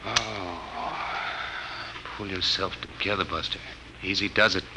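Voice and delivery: soft self-talk to steady the nerves; muttering to himself. Acted. Clinton alone after the shock, softly steadying himself. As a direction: quietly talk yourself down.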